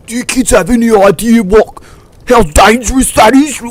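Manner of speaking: high, loud imitation of a furious teacher; hammed-up screeching rant; going ballistic. Mister Rankin berates kids over the horn, high mock fury. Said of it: "absolutely goes ballistic".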